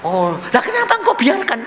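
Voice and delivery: high-pitched questioning; baffled protest. Retold or imitated. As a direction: ask God in disbelief. Acts out baffled complaint to God.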